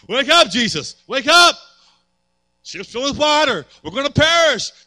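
loud, high panicked cries; frantic shouting in a sinking boat; panicked disciples. Retold or imitated. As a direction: yell like sailors about to drown.

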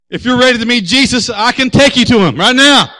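loud, high, heated challenge; retold fighting comeback; mock-evangelist threat. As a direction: threaten him in an evangelist's words, at full volume. Acts own threatening comeback.

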